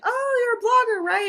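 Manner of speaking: high, loud mock squeal of recognition; put-on high, bubbly voice; imitated excited recognition. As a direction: imitate an author delightedly placing a fan. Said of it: "she, like, was like".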